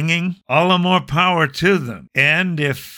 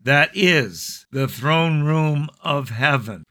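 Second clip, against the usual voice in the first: ordinary talk, nothing marked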